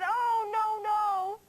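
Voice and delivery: lightly voiced dismayed elephant; little elephant voice. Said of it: "This little elephant said".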